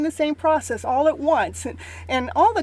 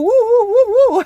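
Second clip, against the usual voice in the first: high, loud whooping; excited cheer; 9 semitones higher, 9 dB louder than usual. Real, not acted. Host greeting viewer Miss Mo, loud high cheer.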